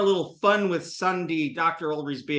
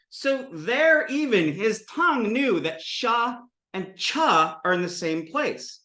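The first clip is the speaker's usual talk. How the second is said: normal talking voice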